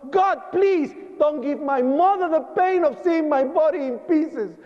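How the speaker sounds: high, pleading prayer to God; desperate prayer. Retold or imitated. Survivor praying under the fire truck, high desperate plea. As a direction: beg God for his mother's sake. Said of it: "the only thing I said".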